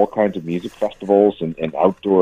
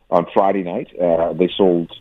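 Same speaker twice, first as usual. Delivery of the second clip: ordinary talk, nothing marked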